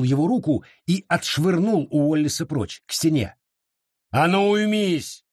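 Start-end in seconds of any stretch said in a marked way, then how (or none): 4.1-5.2 sheriff barks a stern order, higher; sharp shout. A narrator's reading. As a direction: snap the order out slowly. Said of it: "прикрикнул на него шериф".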